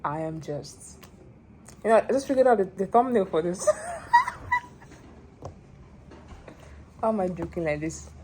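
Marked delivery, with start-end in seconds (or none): 3.7-4.6 real very high-pitched laugh; high giggle. Real, not acted. Vlogger amused by her thumbnail idea, high giggle.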